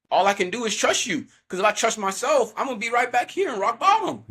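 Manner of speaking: high, fed-up plea, building in pitch; retold prayer rising to exasperation; impassioned plea. Man pleading with God at the cross, impassioned and fed up.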